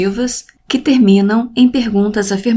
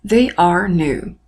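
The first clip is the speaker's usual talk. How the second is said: slow model English; 2 semitones lower, slower than usual. Shown as a demonstration. Teacher modelling English example.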